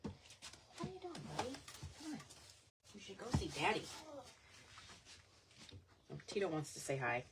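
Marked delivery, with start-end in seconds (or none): 0.0-3.8 pet talk. Real, not acted. Owner coaxing her chihuahua Tito off to Daddy, high baby talk.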